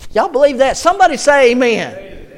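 loud, high preacher's shout; rousing call-out. Real, not acted. Preacher asks his congregation for an amen, rousing shout.

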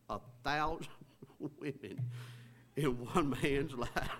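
real laughter breaking up the words; laughing. Real, not acted. Preacher amazed at a thousand women in one man's life, laughing. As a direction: try to repeat it but keep cracking up. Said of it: "can't even say that without laughing".

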